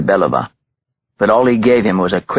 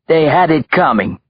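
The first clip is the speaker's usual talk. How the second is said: defiant; 8 semitones higher than usual. A narrator's reading. Captive taunts his victims' father.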